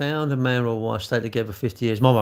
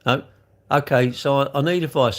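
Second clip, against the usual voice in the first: normal talking voice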